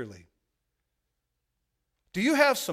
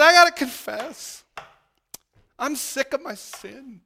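bawling confession; 11 semitones higher, 13 dB louder, slower than usual. Retold or imitated. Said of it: "I was just bawling".

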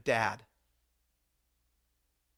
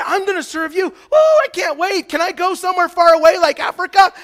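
mock eager volunteer; 14 semitones higher, 15 dB louder than usual. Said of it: "Here's this guy".

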